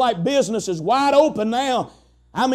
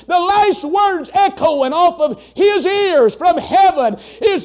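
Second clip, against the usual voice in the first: preaching at full force; 4 semitones higher than usual. Acted. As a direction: lift the church with a rising shout.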